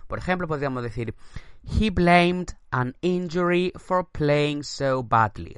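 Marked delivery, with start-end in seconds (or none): 1.6-5.5 slow, carefully spaced English read; slow model sentence. Shown as a demonstration. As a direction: read it out slowly for learners. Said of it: "Repito la frase".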